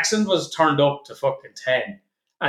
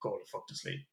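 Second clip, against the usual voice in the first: sleepy plea; 9 dB quieter than usual. Retold or imitated. Retelling sleepy night plea.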